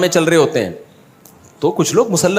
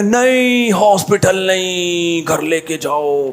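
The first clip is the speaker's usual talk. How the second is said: a high cry on नहीं, then a slow, drawn-out plea; feigned dying plea; 3 semitones higher, much slower than usual. Retold or imitated. Rider faking injury begs to go home, slow and pained. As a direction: beg weakly and slowly, like you're barely alive.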